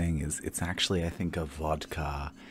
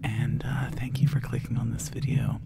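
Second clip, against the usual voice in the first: ASMR whisper; 6 semitones higher, 3 dB louder, much slower than usual. Acted.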